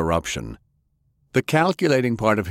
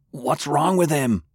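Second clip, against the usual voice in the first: higher-pitched, alarmed question; 7 semitones higher than usual. A narrator's reading. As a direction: ask in alarm for someone you love. Grandfather convulses, Francis alarmed.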